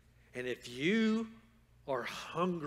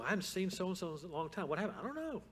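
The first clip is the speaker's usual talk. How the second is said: higher-pitched mock chit-chat; puzzled church member; 5 semitones higher, faster than usual. Church members chatting about someone missing, puzzled and higher-pitched.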